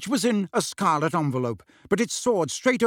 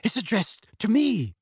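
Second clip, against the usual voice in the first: high, tremulous and hesitant; shaking voice; 6 semitones higher than usual. A narrator's reading. Aunt holding a frightening letter, shaking voice. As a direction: say it as if trembling with fear. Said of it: "in a shaking voice".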